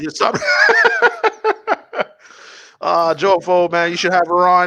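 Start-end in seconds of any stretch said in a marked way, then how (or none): 0.4-2.0 shrieking laugh. Real, not acted. Laughing at the joke, high shrieking cackle. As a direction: burst into a shrill cackle.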